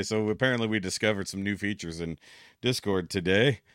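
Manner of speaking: normal talking voice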